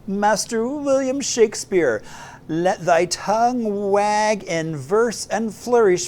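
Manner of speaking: grand, slow, booming proclamation; loud mock Shakespearean. Host declaring Talk Like Shakespeare Day, loud mock-Shakespearean proclamation. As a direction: declaim it like a hammy town crier of Shakespeare's day. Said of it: "they talk loud".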